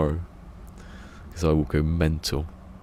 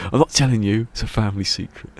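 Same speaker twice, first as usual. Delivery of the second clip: louder, higher teasing refusal; smug driver; 5 semitones higher, 5 dB louder than usual. Retold or imitated. The taxi driver refusing to share the recipe, smug. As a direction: hold the secret back smugly.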